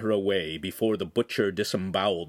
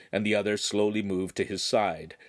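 ordinary delivery, nothing marked

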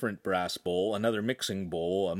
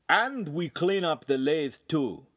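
Italian master; 6 semitones higher, 3 dB louder, slower than usual. Acted.

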